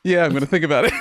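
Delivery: breaking into giggles; laughing. Real, not acted. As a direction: laugh through the words. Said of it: "could not keep a straight face".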